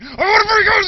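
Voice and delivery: very high, loud scream; dad screams for a free couch. Retold or imitated. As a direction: scream the demand at him. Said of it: "they're screaming at the salesman".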